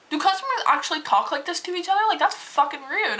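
can't believe people talk like this; indignant. Retold or imitated. Cashier appalled at the rude customer, indignant.

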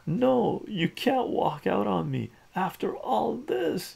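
high, urgent plea; desperate protest. A narrator's reading. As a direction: protest as the girlfriend walks out. Hero stops girlfriend walking out.

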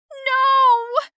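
long, high, loud yell. Acted. Girl with a mouthful of air, long high yell. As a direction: let out a drawn-out high shout. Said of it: "I yelled".

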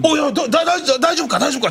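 high, stammering worry; flustered startled check-in; alarmed concern. Retold or imitated. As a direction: stumble over the words in alarm. Speaker alarmed, checking on partner.

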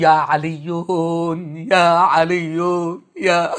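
hushed distressed invocation; quiet plea. Shown as a demonstration. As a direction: call يا علي softly, like a troubled man. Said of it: "حينما تكون مهموما تقول".

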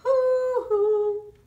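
high, loud hooting; sung owl hoo for the children. Acted. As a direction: hoot it like a baby owl. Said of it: "Try it again".

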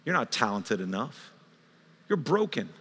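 soft, low accusing tempter; quiet accusation. Acted. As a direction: undermine them in a hushed voice. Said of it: "pointing an accusing finger at you".

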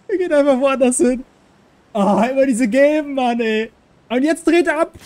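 loud, high exasperated rant; frustrated outburst. Real, not acted. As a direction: vent at the careless teammate. Teammate runs elsewhere, frustrated. (genervt und laut)

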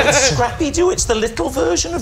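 high squeaky voice. Acted. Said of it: "look what you've done to my voice".

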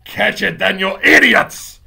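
high, very loud furious command; loud villainess shriek, stretched on the insult; screaming witch. Acted. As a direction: scream at your useless underlings. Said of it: "screamed the Grand High Witch".

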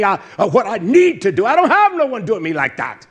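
rising to a high, loud insistence; heated pulpit declaration; forceful preaching. Real, not acted. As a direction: preach it emphatically, building to a cry.

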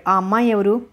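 ordinary delivery

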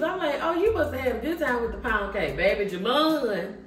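usual speaking voice

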